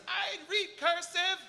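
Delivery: put-on high, drawn-out kid heckle; yelling little kid. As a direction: imitate a kid calling from the balcony. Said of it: "little kid in the balcony yells".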